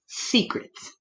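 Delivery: whispered secrets. Acted. Teacher whispering secrets to the children. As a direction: breathe the word like a secret.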